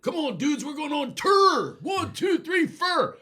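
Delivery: high rock star count-in yell; [name] shout. Retold or imitated. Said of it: "[name] doesn't go on tour".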